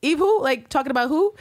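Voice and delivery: mock-innocent high questioning; gaslighting feigned ignorance. Constantine toying with Villanelle about Eve, feigning ignorance. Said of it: "being a fucker with her and a gaslighter".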